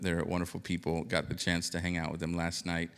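ordinary talk, nothing marked

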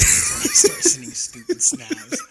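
high, loud laughter over the words; laughing. Real, not acted. Hunter admitting boredom to friend, laughing. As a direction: crack up at yourself.

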